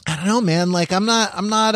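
usual speaking voice

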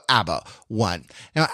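normal talking voice

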